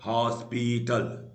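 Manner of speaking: Hospital drawn out in three beats; syllable by syllable. Shown as a demonstration. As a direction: stretch each syllable of hospital. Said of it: "तीन बार उठा".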